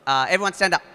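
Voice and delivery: loud instruction to a crowd; calling out. Real, not acted. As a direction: call the room to their feet. Calling the room to stand.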